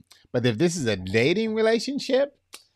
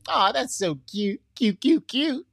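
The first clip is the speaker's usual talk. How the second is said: gushing mock; 10 semitones higher than usual. Mimics new couple's gushing.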